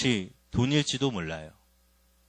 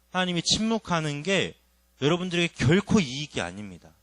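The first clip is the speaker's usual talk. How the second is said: normal talking voice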